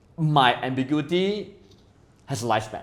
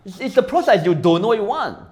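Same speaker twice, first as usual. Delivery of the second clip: high, excited rave about life; rising up in giddy wonder; excited gushing; 8 semitones higher, 3 dB louder than usual. Real, not acted. Talking to friends, raving excitedly that life is amazing.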